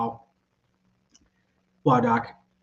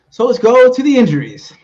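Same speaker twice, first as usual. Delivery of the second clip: loud, high announcer shout; segment toss; 10 semitones higher, 10 dB louder than usual. Pretend. Host sending listeners to the injuries, high loud hype. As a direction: throw to the jingle at full voice.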